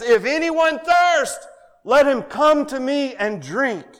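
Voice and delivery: high, loud, slow proclaiming cry; Jesus crying out to the festival crowd, loud and slow. Acted. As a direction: call out over a great crowd in the temple. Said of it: "He cries out and He says".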